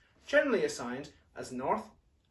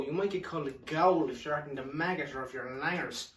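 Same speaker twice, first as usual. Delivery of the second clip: normal talking voice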